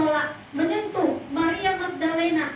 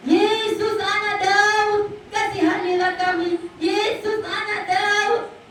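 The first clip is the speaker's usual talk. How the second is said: slow, drawn-out, higher calling out; desperate cry; 6 semitones higher, slower than usual. Acted. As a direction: plead aloud across the crowd, stretching each word. Bartimaeus crying out to Jesus.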